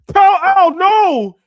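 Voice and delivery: screeching way up high and loud, mocking Gal Gadot's line. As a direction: scream the line as a shrill parody of Gal Gadot. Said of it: "she said in Justice League".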